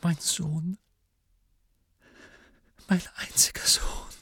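high, fragile, breathy grief; tearful whisper. A narrator's reading. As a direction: whisper it through tears, with long pauses. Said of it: "Tränen glitten ihr über die blassen Wangen".

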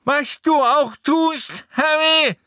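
put-on high, slow, loud boy's voice with a broken nose; Neville, furious and hurting; angry Neville. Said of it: "sagte Neville wütend".

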